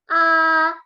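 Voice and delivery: A held long on a high, level tone; first tone, flat. Shown as a demonstration. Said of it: "Untuk nada pertama". (A nada datar, tinggi dan panjang)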